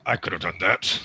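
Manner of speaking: low, half-hearted grumble; mock grumble, meant as under the breath; grumbling. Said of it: "I grumble under my breath".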